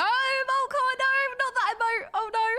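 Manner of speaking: real panic at the wrong button; alarmed squeal. Real, not acted.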